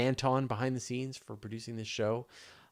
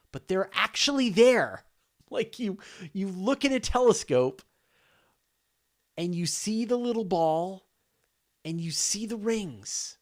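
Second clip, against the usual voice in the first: excited; 10 semitones higher, 8 dB louder than usual. Real, not acted. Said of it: "excited about seeing Saturn".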